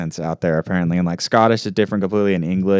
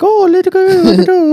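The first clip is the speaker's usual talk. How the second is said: squeaky mock Irish, far higher and louder; 9 dB louder than usual. Friend mocks the co-host's Irish voice in a squeaky mock Irish. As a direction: squeal it like a cartoon leprechaun. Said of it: "making it all like".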